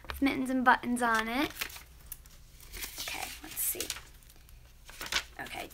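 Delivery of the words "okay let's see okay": soft whispered aside; hushed whisper before reading. Real, not acted. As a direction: whisper it to yourself. Opening next fan letter.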